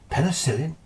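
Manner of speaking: a high questioning repeat of "Penicillin?" that rises at the end; baffled, rising "Penicillin?"; puzzled question. Retold or imitated. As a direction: echo "Penicillin?" back in mild disbelief. Doctor puzzled by unknown word.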